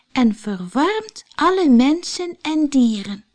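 put-on a little girl proudly reading rhymes aloud; girl reading a poem. Heidi reads verse to grandmother.

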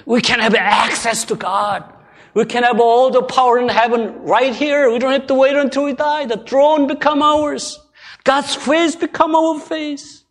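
loud, high proclamation; full-force preaching. Real, not acted. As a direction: proclaim it triumphantly from the pulpit.

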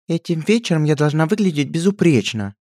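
high, prim princess voice, lightly affected; a spoiled princess stating her demands; haughty princess. A narrator's reading. Princess demanding to look flawless, haughty high voice. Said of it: "заявила та самая принцесса".

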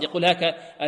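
ordinary talk

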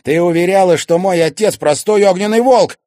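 loud, high reproach; accusing shout. A narrator's reading. Son confronting mother about his father, shouting. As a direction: shout the old lie back at her.

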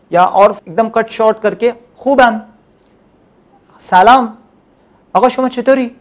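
ordinary delivery

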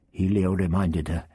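ordinary delivery, nothing marked

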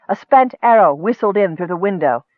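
plain reading voice

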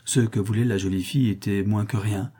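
usual speaking voice

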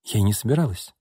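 ordinary delivery

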